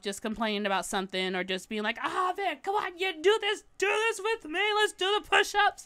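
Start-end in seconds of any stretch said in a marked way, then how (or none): 2.0-5.9 higher, playful pushy coaxing; mock chaotic gym pep. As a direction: egg her friend on in gym class. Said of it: "my regular chaotic self".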